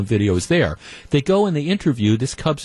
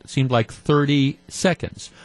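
normal talking voice

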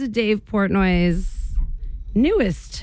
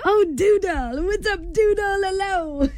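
put-on much higher cooing baby talk with a laugh at the end; dog voice; 11 semitones higher, slower than usual. Host showing how she greets her doodle, high cooing baby talk. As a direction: coo at your doodle like a baby. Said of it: "I'm like".

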